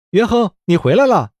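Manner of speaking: high-pitched, happy welcome; delighted greeting. A narrator's reading. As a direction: greet a returning friend with glee. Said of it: "罗西西兴高采烈地问道". (兴高采烈地高声招呼)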